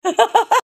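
a burst of high laughter. Real, not acted. After hanging up on the scammer, a high laugh. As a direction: laugh off the call.

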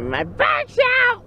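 high mock cry; mock yell from mom. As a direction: squeal it like a hurt mom. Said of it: "Mom would be just like".

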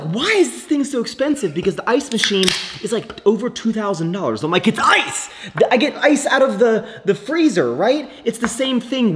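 high-pitched, exasperated protest; incredulous complaint. Retold or imitated. Arguing over the ice machine's price, incredulous. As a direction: protest it like it's absurd. Acting out his old objection about price.

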